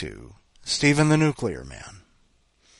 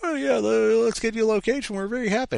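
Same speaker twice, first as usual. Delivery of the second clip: much higher, louder chirpy clerk voice; mocking MetLife rep; 12 semitones higher, 4 dB louder than usual. Mocking the cheery MetLife rep, high chirpy voice. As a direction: overdo the cheerful helpfulness.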